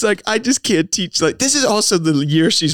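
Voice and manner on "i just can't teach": higher-pitched mild mock distress; retold tearful professor. Professor gives up on her Zoom class, mildly tearful. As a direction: imitate an upset teacher giving up, lightly. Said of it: "then she starts crying".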